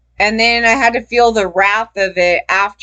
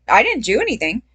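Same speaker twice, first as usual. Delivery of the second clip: ordinary delivery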